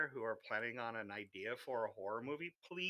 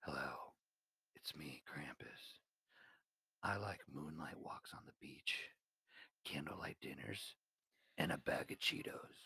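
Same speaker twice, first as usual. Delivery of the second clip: low whispery Krampus voice; 10 semitones lower, 11 dB quieter than usual. Acted. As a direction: whisper it low like a creepy monster. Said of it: "That's how Dale put it".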